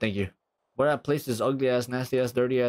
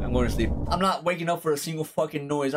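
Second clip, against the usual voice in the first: usual speaking voice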